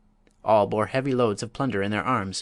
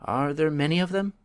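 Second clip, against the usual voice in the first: tremblingly; 6 semitones higher than usual. A narrator's reading.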